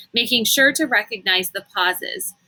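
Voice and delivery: ordinary delivery, nothing marked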